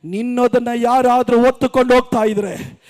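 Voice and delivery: building fervent preaching. Real, not acted. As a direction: drive the sermon up to a shouted climax. Preaching builds to full shout. (ಏರುತ್ತಾ ಹೋಗುವ ಆವೇಶದ ಬೋಧನೆ)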